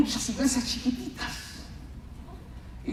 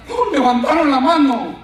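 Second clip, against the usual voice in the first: explosive, much louder burst; sudden exclamation; 2 semitones higher, 10 dB louder than usual. Retold or imitated. Preacher telling how the elders' hands shot up, explosive. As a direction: burst out loudly on "Pum".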